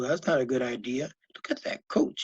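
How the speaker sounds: ordinary delivery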